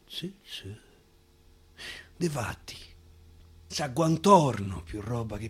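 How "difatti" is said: ordinary delivery, nothing marked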